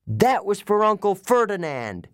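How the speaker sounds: high triumphant yell; yelling back. A narrator's reading. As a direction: shout it back proudly. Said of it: "Grover yelled back".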